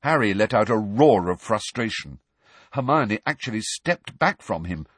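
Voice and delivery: usual speaking voice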